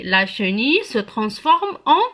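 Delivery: normal talking voice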